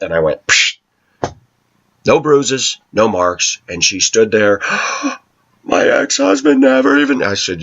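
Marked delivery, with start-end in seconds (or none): 0.5-0.8 short, voiceless burst of air; imitated slap sound. 4.7-5.1 high, exaggerated intake of breath; mock shocked gasp. Mimics ex's shocked reaction. 5.6-7.2 very high falsetto imitation; mock outraged ex-wife. Mimics outraged ex after slap.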